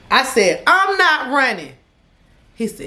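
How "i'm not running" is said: higher, louder, slower insistence; firm refusal. Retold or imitated. Telling her ex she won't run, higher and louder. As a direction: plant your feet and refuse.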